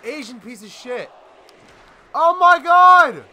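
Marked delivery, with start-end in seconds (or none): 2.1-3.2 stretched-out, much louder and higher shock; shocked yell. Real, not acted. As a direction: scream it in real disbelief. Gamer reacts to brutal beating.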